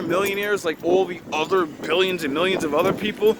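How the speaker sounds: usual speaking voice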